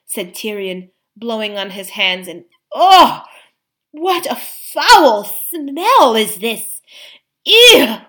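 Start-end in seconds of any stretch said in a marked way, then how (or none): 2.7-6.8 loud, high, drawn-out revulsion; disgusted exclamation. Acted. Characters hit by a stench. 7.5-8.0 high, loud "ew" of revulsion; gasped disgust. Acted. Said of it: "gasped Eustace".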